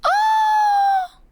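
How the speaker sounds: very high, loud squealed "oh"; piercing high fangirl shriek; excited squeal. Real, not acted. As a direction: squeal it out in delight. Excited reaction to character.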